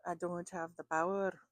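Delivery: horrible attempt at a Scottish accent; Scotty. Reader imitating what she heard in her head, bad Scottish accent. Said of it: "that was a horrible accent".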